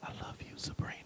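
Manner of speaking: soft unvoiced bedtime whisper; a loving whisper at her bedside; tender whisper. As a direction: breathe it into a sleeping child's ear. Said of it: "whispered in Sabrina's ear".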